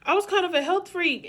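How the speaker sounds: high, loud, stressing kind. Shown as a demonstration. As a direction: hit kind hard and loud.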